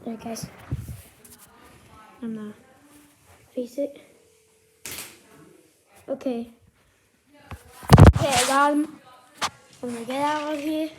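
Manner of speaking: ordinary delivery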